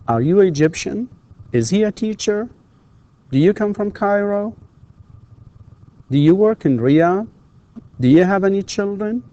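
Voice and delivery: put-on high questions lifting on "Egyptian", "teacher", "Cairo", "Riyadh", "children"; rising intonation. Shown as a demonstration. Teacher runs through yes-no questions for learners, rising intonation. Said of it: "of course, with rising intonation".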